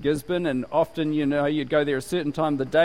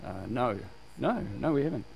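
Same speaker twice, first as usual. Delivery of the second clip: hesitant quiet no; 5 semitones lower, 8 dB quieter than usual. Retold or imitated. Group hesitantly refusing.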